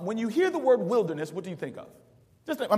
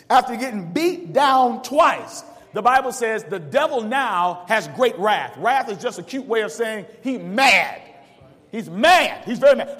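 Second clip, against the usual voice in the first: slow, louder, building preacher's cry; preaching hard; 4 semitones higher, 8 dB louder than usual. Acted. Preacher to congregation, slow and loud, building. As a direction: lean into it and preach. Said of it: "I'm gonna preach this thing today".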